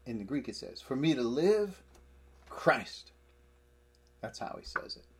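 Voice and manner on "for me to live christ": LIVE high and loud, pause, CHRIST punched alone; emphatic. Shown as a demonstration. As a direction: build up to LIVE, stop, then declare CHRIST. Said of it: "He is emphatic as he is saying this".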